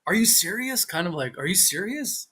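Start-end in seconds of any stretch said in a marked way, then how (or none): 0.1-0.8 high, rising incredulous question; mild disbelief, pitched up. Shown as a demonstration. Giving the meaning of a Dutch phrase, incredulous. 1.4-2.3 higher, rising disbelieving question; incredulous. Shown as a demonstration. Repeating the meaning for the listener, incredulous.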